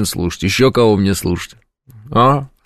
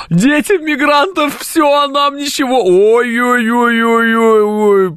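much higher, slow whining voice; whiny complainer; 13 semitones higher, slower than usual. Retold or imitated. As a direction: whine it out like a moaning complainer. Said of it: "А вот эти, которые". (высоким ноющим голосом, жалуясь)

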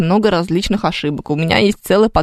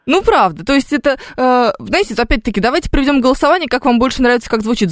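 usual speaking voice

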